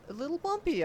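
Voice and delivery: put-on the kid echoes the uncle in disbelief; incredulous echo. A narrator's reading. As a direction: throw the words back in disbelief. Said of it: "I say".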